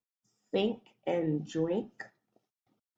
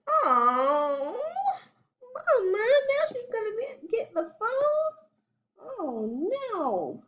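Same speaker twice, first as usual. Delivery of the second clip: moaning; 13 semitones higher than usual. A narrator's reading.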